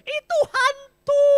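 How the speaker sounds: high terrified shriek, loud and slow; panicked screaming. Acted. Disciples scream in panic at a ghost on the water. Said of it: "sambil berteriak-teriak, sambil panik".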